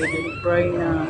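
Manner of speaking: slow, gentle plea to stop crying; tender coaxing. Real, not acted. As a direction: soothe a whimpering puppy slowly. Comforting a crying puppy. (mabagal at malambing na pag-aalo)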